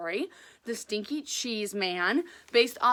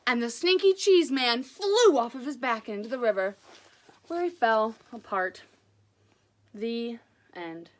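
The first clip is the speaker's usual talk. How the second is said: lively read-aloud storytelling voice; animated children's story reading; story narration; 2 semitones higher, 3 dB louder than usual. A narrator's reading. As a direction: read it like a picture book to kids.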